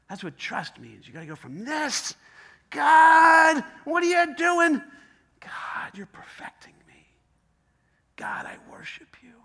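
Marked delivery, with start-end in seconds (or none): 2.7-4.8 high, loud cry; anguished outcry. Acted. Believer crying out loudly to God in hardship. 5.4-9.3 unvoiced, hushed prayer; whispered surrender. Believer whispering surrender to God.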